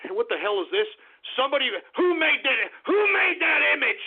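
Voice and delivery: ordinary talk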